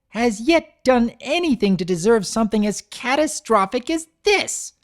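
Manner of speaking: high, loud, indignant outburst; outraged unicorn. Acted. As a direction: she can't believe this happened to her. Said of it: "but it didn't last".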